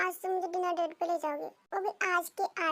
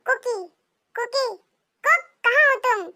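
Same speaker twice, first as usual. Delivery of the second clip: raised, higher-pitched call to someone in another room; 6 semitones higher, 11 dB louder than usual. Acted. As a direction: summon him loudly from the kitchen. Calling Jungkook from another room.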